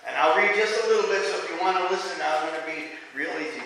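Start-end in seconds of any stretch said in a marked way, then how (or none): none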